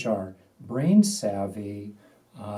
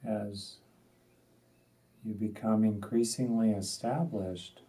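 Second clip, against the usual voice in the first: unhurried, spacious delivery, letting each instruction settle; guided meditation. Acted. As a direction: guide them gently into stillness, phrase by phrase.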